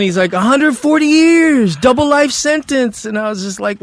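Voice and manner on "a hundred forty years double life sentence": high, loud, slow boast; retold inmate holler. Inmate telling newcomer his sentence, imitated high loud holler.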